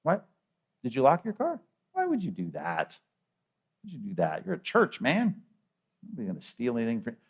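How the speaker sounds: hushed, playful incredulity; mock disbelief. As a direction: drop your voice, feigning disbelief at a listener. Mock disbelief at locking car at church.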